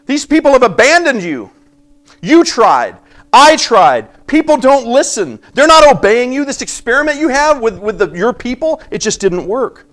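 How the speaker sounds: a prophet throwing up his hands at God; mock despairing Elijah; voicing Elijah's complaint. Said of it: "what he's basically saying is".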